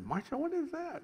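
retold puzzled question. Preacher asking Marcia about a weird noise, retold in high bewilderment. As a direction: shoot the pitch right up, softly baffled.

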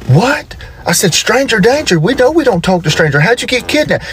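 high-pitched dad admonishing; alarmed disbelief. Acted. Dad reminding daughter about strangers, high alarm. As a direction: react in high, startled protest.

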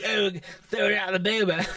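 imitating a sister's Australian accent. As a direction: put on an Aussie accent for the quote. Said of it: "she does, like, says certain".

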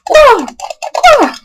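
sharp high-pitched yelps. Real, not acted. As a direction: yelp out suddenly, high. High yelp while playing.

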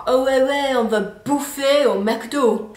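imitating someone keen on McDonald's; mock casual slangy voice. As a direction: toss it off like a casual mate. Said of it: "I really don't like it".

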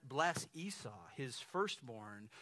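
ordinary talk

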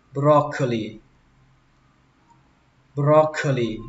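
normal talking voice

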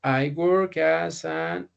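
very slow, word by word; teacher dictating a phrase slowly; slow model phrase. Shown as a demonstration. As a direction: drag each word out for learners. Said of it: "Le podría decir yo".

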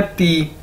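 careful teacher's "ती"; demonstrated pronunciation. Shown as a demonstration. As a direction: model "ती" quietly for learners. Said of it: "कैसे pronounce करते हैं".

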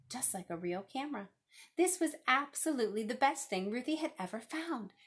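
ordinary delivery, nothing marked

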